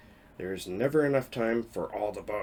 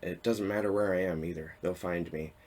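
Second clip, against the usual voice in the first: normal talking voice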